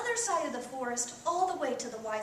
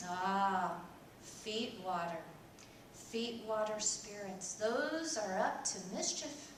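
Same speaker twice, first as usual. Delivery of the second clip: low, slow hint of an old woman; slow, knowing old woman; lightly voiced wise old woman; 3 semitones lower, 4 dB quieter, slower than usual.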